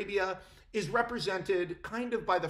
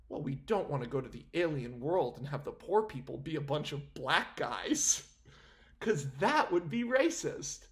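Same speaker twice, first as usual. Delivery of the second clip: mocking executives; 7 semitones lower than usual. Vlogger imitating the studio executives, in lightly mocking voice. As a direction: play a nervous studio suit, lightly. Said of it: "they're like".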